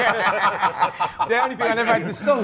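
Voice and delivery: laughing through the words, quick and high; real laughter breaking into the words. Real, not acted. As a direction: chuckle it out as you answer. Laughing at market trader joke.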